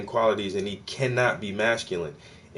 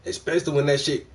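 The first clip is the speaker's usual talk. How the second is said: usual speaking voice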